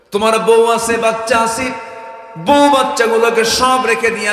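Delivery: loud, high-pitched preacher's cry; full-force preaching. Retold or imitated. Preacher voicing the Prophet's question to his companion, impassioned loud preaching. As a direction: hurl the quoted question out to the crowd.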